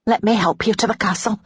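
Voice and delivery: maid's concerned urging. A narrator's reading. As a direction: urge her gently along. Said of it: "Naomi urged".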